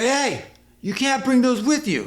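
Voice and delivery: hammed-up angry bartender calling after him; mock mad bartender. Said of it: "Like, super mad".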